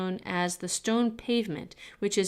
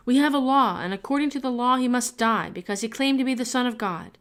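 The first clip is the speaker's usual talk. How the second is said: loud, firm, higher insistence; insisting loudly; 5 semitones higher, 6 dB louder than usual. A narrator's reading. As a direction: press the point hard.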